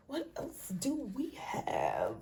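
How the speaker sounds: slow hushed whisper. Acted. Whispering while flipping through her planner. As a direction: whisper it as you search.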